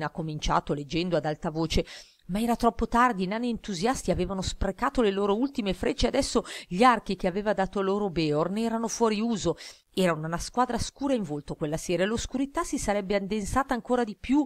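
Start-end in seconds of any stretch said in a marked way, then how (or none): none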